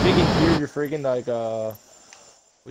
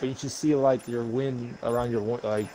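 usual speaking voice

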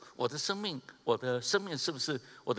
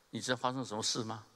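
ordinary talk

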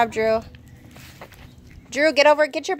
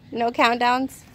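ordinary delivery

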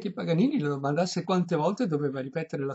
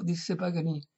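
ordinary delivery, nothing marked

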